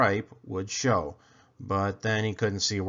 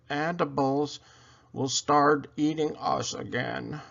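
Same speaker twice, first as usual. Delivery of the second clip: high, stuffed-up squirrel voice, slowing on key words; squirrel with a cold; 5 semitones higher, slower than usual. Acted. Squirrel with a cold warning William, high stuffed-up voice. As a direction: squeak it out through a blocked nose. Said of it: "A squirrel with a bad cold and a stuffed-up nose".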